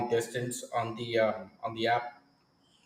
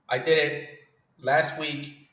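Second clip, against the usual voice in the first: normal talking voice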